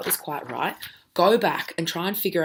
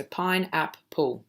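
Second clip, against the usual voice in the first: pineapple said slowly and clearly; slowed pineapple for young learners; slow model word; 3 dB quieter, much slower than usual. Shown as a demonstration. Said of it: "so syllables".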